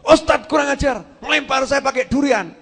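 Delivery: loud, high cry of outrage; mock angry outburst. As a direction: yelp and curse as the indignant victim. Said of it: "Marah, ma-, marah".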